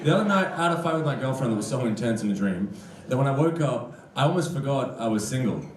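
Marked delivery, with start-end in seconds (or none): none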